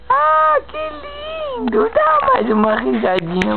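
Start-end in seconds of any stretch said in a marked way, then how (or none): 0.1-2.2 delighted high coo. Real, not acted. Mother delighted by baby.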